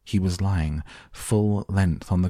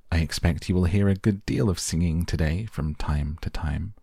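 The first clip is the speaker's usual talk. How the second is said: ordinary delivery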